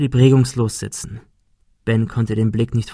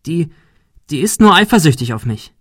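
desperate defence; 5 semitones higher, 5 dB louder than usual. Acted. Girl defends herself.

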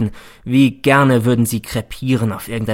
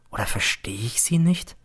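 a hushed, uncertain whisper; a soft whispered self-doubt; 6 dB quieter than usual. Acted. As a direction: ask yourself quietly. Doubting himself.

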